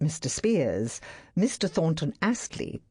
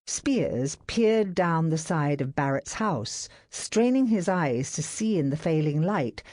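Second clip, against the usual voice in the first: ordinary delivery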